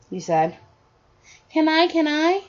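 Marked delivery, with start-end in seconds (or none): 1.5-2.4 put-on high, pleading little-kid voice; eager child. Son begging his parent for a turn, eager high voice. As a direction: beg like a small child, pitched up.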